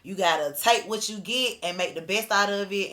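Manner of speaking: normal talking voice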